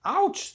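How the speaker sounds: sudden high loud yelp. A narrator's reading. Pig yelps as dragonfly bumps his nose. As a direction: squeal as if bumped on the nose. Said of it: "says the small pig".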